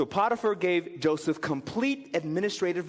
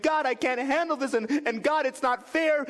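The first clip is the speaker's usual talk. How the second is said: crying out; 8 semitones higher than usual. Retold or imitated. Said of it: "are crying out to God".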